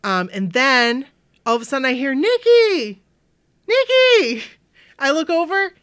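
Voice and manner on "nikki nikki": much higher calling across the crowd. Retold or imitated. Friend calling to the teller across the tents, high and loud. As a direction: call her name across the tents. A friend calls her name.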